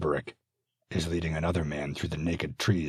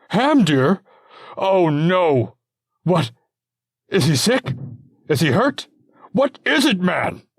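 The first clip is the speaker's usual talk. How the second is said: desperate questions, much higher and louder; father terrified for his son Hamdir; frantic; 12 semitones higher, 8 dB louder than usual. Acted.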